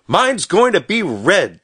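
high, loud, drawn-out boast; child's shout. A narrator's reading. Stubby running back with his hands full, shouting. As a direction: shout it slowly in an excited little-boy voice. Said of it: "shouted Stubby".

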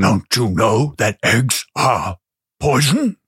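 slow, drawn-out Gnome King voice; slow character voice with pauses. Acted. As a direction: play the Gnome King, stretching the words out slowly. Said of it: "the Nome King doesn't like chickens".

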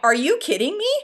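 mock disbelief. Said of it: "And I was like".

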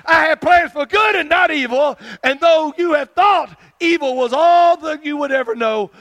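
shouted, high prophetic promise; proclaiming as God. Acted. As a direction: cry it out like a prophet at full force. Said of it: "says the Lord God".

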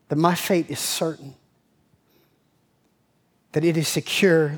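low, subdued prayer voice; prayerful. Real, not acted. Pastor closing a prayer of thanks to the Lord, prayerful. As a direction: pray gently and low, in reverent thanks.

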